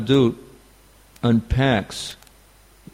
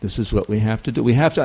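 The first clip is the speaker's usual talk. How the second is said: usual speaking voice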